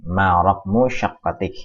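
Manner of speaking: "Ma raqmu syaqqatik" said slowly, stress on "raqmu"; slow dictation of "Ma raqmu syaqqatik"; slow repeat. Shown as a demonstration. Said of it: "teacher repeats the question slowly".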